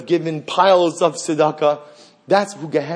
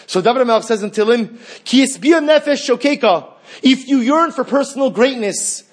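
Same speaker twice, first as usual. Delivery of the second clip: high, loud, driving sermon voice; preaching at full force; 8 semitones higher, 4 dB louder than usual. Acted. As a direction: preach it out to the congregation.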